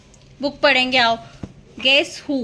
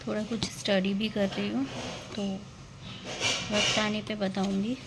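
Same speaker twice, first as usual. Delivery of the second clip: hushed aside; 9 dB quieter than usual. Real, not acted. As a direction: murmur to the viewers softly. Quiet aside to viewers.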